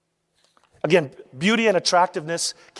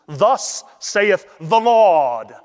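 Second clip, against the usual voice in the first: slow, louder, theatrical pronouncement; drawn-out mock-solemn proclamation; hammy stage preacher; 5 dB louder, much slower than usual. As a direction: ham it up like an actor playing a prophet. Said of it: "That's acting, of course".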